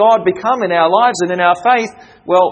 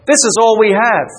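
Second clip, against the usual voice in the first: higher, exasperated plea to the Lord; frustrated; 4 semitones higher, 3 dB louder than usual. Acted. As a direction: protest helplessly, pitch raised. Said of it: "you can see his frustration".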